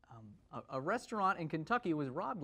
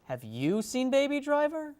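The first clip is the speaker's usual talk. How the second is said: much higher, louder, slow disbelief; incredulous echo; 10 semitones higher, 6 dB louder, slower than usual. Acted. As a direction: throw her words back in disbelief. Anchor echoes incredulously.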